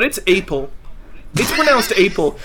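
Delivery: "Apple" said as "ape-ul"; long A as in "ape" in "Apple"; mock mispronunciation. Mocking the gif argument by analogy, "Apple" as "ape-ul". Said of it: "like if you were like".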